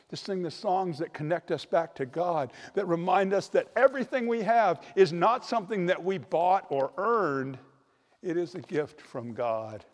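ordinary delivery

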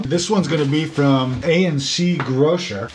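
ordinary delivery

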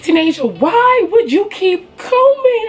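high, drawn-out exasperated mom; mock yelling. Mom home and seeing the hair, imitated yelling. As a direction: imitate mom wailing a scolding, slowly. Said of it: "she just started yelling".

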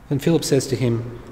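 ordinary talk

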